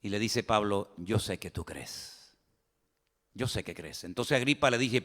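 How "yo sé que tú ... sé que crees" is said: much softer, slow and lower; quiet, slow, tender assurance as Paul; soft slow reply. Acted. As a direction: say it gently, almost to himself. Paul's gentle reply.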